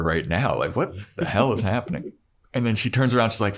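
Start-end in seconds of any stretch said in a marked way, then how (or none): none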